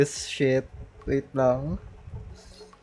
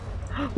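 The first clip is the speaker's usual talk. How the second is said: quick high gasp of alarm; startled intake of breath; sharp gasp; 9 semitones higher, 12 dB quieter than usual. Real, not acted. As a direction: suck in air in shock. Gacha pull suspense.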